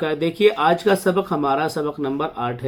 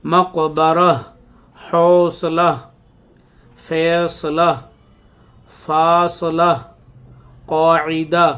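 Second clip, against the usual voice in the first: each word a touch louder, spaced apart; slow dictation reading; 4 dB louder, much slower than usual. Acted. Teacher reading more examples to students. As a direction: read the words one by one for the class.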